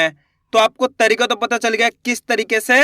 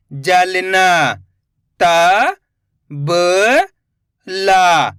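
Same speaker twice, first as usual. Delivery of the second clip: slow syllable drill; 3 semitones lower, much slower than usual. Shown as a demonstration. Teacher sounding out letters for children, slow and clear.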